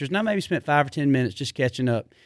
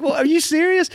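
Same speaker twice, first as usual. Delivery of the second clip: much higher, incredulous cry; outraged disbelief; 12 semitones higher, 3 dB louder than usual. Retold or imitated. To Blake in his bus, high indignant disbelief.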